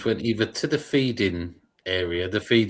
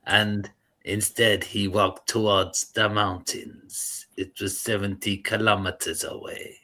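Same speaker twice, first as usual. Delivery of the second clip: Hans Cleanser. Retold or imitated. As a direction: mimic a nature narrator, drawing out the words. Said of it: "he talks like this".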